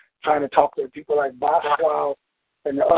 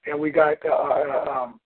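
ordinary talk, nothing marked

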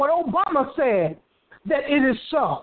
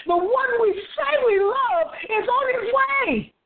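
fervent preaching; 7 semitones higher than usual. Real, not acted. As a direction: preach it with rising, urgent fervour. Preaches with rising fervour.

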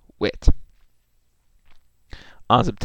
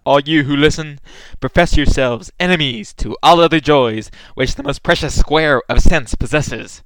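loud, grand theatrical declaiming; hammy stage actor; 5 dB louder than usual. Acted. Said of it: "mock Shakespearean profession".

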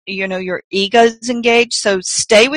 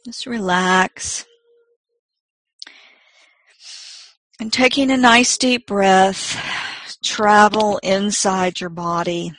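slow, soft meditation voice; guided meditation; 4 dB quieter than usual. Acted. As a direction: gently guide them inward, unhurried.

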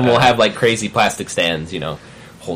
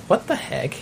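surprised; 11 semitones higher, 6 dB quieter than usual. Retold or imitated. Reacting to weird tweets.

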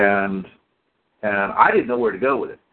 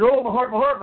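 high-pitched imitation of a heart complaint; mock ailing old man; 9 semitones higher than usual. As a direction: mimic his put-on heart trouble. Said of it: "a man that goes".

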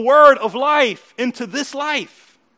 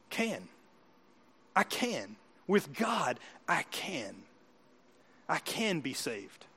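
soft, low, spaced-out affirmation; quiet assurance; 7 semitones lower, 12 dB quieter, slower than usual. Acted. Preacher giving God's answer to the doubting, quietly assured. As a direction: let each phrase land softly and low.

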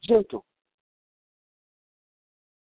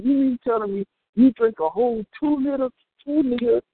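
ordinary talk

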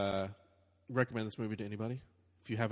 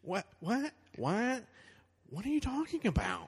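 Owen Wilson voice; 11 semitones higher than usual. Pretend. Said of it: "an actual Joker voice".

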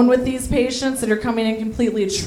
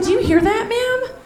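scared tripping patient; 11 semitones higher than usual. Retold or imitated. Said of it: "And he was like".

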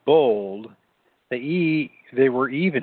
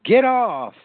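much higher, desperate cry; narrated shriek, pitched well up; 9 semitones higher, 3 dB louder than usual. A narrator's reading. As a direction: cry out at an attacker. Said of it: "he shrieked".

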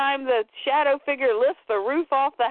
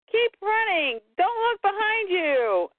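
mock-urgent comic-book hero warning; acted urgent warning; 7 semitones higher than usual. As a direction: urge them on, pitched up and stretched.

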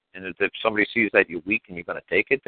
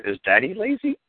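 put-on much higher, sing-song question to a child; teasing question to kid; 11 semitones higher than usual. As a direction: bait the kid with a high playful question. Father quizzing his son.

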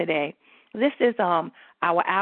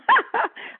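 bright laugh. Real, not acted. Laughing at her own confidence.

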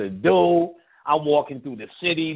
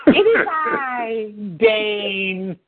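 slow, stately "It is I, Dane"; a mock-regal entrance, stretched slow; grand slow Dane impression; 6 semitones higher, much slower than usual. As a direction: announce yourself like royalty. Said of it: "repeats Dane bit".

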